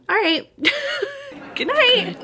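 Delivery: high sign-off. Real, not acted. Vlogger signing off to viewers, high cheerful goodnight.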